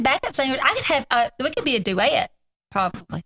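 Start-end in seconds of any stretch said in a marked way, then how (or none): 1.8-2.3 "duet" stretched into three syllables, du-ay-et; three-syllable Southern duet. Real, not acted. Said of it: "duet as a three-syllable word".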